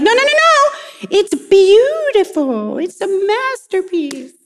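put-on high, sing-song reassurance to a child; mock-gushing parent; parent reassuring kid. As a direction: coo over the child's drawing. Parent soothing child about artwork.

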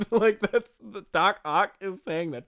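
slow words breaking up with laughter; laughing. Real, not acted. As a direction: crack up in disbelief. Cracking up at own line.

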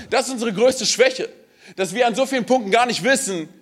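high, intense preaching voice with shouts; preaching climax. Acted. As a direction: lift the crowd to the peak. (leidenschaftlicher Höhepunkt der Predigt)

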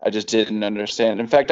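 ordinary talk, nothing marked

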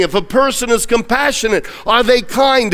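normal talking voice

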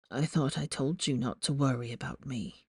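low, hoarse and quiet. A narrator's reading. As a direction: rasp it weakly from a sickbed. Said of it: "A hoarse voice broke the silence".

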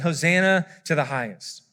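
ordinary talk

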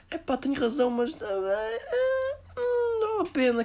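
very high fake sobbing whine; hammed-up blubbering refusal; mock whiny crying. A resigned worker refusing to complain, hammed-up high sobbing. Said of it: "somos assim para tudo".

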